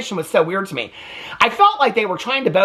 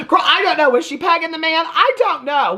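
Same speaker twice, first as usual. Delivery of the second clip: incredulous sass; 11 semitones higher than usual. Real, not acted. Recapper scoffing at Janelle's taste, incredulous and sassy. Mocking Cody's appeal.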